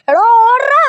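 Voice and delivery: high loud yell to run; run shout. Acted. Telling Shinchan to flee from the ghost, loud yell. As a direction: bellow at him to run.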